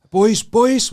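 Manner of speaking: loud calling out to the lads. Retold or imitated. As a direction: call them over across the courtyard. Woman calling to strangers.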